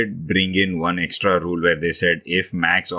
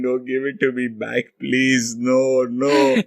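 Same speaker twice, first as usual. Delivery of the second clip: put-on high, pleading child-like protest; mock shouting; 6 semitones higher, slower than usual. Someone taking a toy away from a kid, mock pleading shout. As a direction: play up a desperate plea in a raised voice. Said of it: "the toy shouting".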